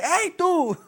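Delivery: high laughing call across the store; retold yell. Friend yelling to a Walmart worker, high and amused. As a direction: call out to a stranger, half laughing. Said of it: "Le grita".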